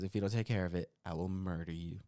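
low, softly menacing retelling; quiet threat. Retold or imitated. Husband quotes wife's warning about the boots, quietly threatening. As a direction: drop low for a mock death threat. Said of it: "she wasn't that nice about it".

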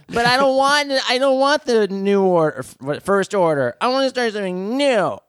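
high, whiny complaining voice; whiny teen. Acted. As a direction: sulk like a moody teenager. Kylo whining, quoted.

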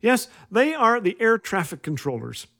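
usual speaking voice

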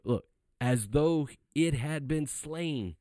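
high-pitched, emphatic sermon delivery; impassioned preaching. Real, not acted. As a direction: drive each declaration home like a preacher. Preaches on the conquering Lamb.

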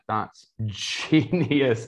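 very slow, drawn-out admiration; amazed reaction. Retold or imitated. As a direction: stretch out "genius" in retold amazement. Acting his amazed reaction to clients.

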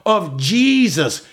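high, drawn-out sermon climax; emphatic preaching. Real, not acted. Preacher assuring believers they are complete, emphatic preaching. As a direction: proclaim it slowly, punching each word.

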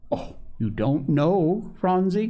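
high, drawn-out surprise; amazed at a younger child, rising; crying out. A narrator's reading. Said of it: "cried Polly".

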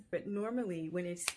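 ordinary talk, nothing marked

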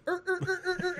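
very high squeaky imitated sled creaking; sled noise. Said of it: "the sled just goes".

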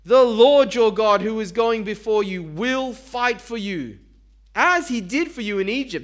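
a preacher voicing Moses' plea; earnest reassurance. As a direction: reassure the frightened people. Moses pleading with the people.

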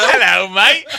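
loud, high mock British greeting; British accent. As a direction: ham up a British hello through laughter. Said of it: "Do a British accent".

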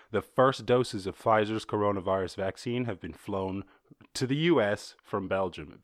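ordinary talk, nothing marked

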